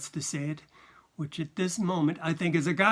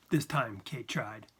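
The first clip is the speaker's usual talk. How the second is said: plain reading voice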